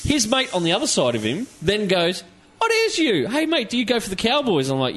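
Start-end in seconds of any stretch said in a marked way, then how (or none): 2.6-4.7 imitating a chatty stranger, high-pitched; excited greeting. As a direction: play the mate recognising someone, voice raised high. Retelling the mate's excited greeting.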